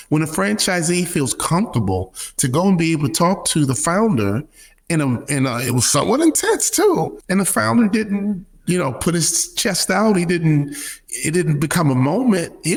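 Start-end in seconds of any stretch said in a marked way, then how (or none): none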